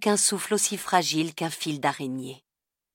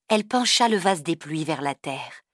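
ordinary delivery, nothing marked

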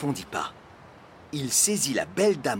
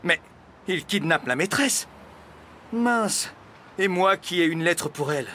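mildly raised exclamation of dismay; exclaiming; 5 semitones higher than usual. A narrator's reading. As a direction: exclaim in mild alarm. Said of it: "et s'écria". (exclamation un peu alarmée)

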